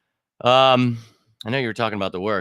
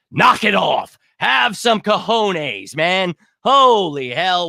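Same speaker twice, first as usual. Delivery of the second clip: fired-up rant; 6 semitones higher, 4 dB louder, slower than usual. Real, not acted. Ranting at fans, shouting.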